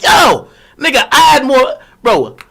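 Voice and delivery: high, loud exasperated shout; retold pissed-off protest. Streamer replays arguing over the pills he had, pissed off. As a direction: protest loudly, stretching out the first words. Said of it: "So now I'm pissed".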